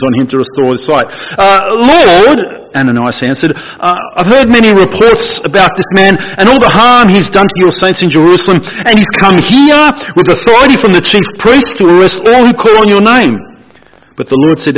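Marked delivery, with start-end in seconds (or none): 1.4-2.6 loud, high, drawn-out objection; hesitant start, then a pleading stretch on Lord; protesting Ananias. Acted. Ananias answers the Lord, protesting. 3.5-13.4 raised, worked-up voice, building in alarm; protesting Ananias. Acted. Ananias objects to being sent to Saul, protesting.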